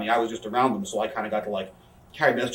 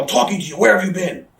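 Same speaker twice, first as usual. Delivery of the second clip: loud, high-pitched imitation of a yelling boss; Vince yelling; 8 semitones higher, 8 dB louder than usual. Boss to an employee looking around, yelling. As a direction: bellow it at someone ignoring you. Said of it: "Vince starts yelling".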